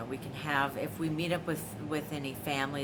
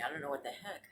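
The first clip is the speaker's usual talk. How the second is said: ordinary talk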